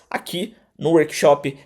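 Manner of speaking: usual speaking voice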